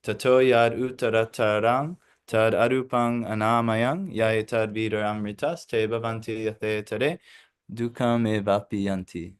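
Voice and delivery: chanted scripture, a bit unsure; Sanskrit recitation. Acted. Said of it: "I didn't say that right".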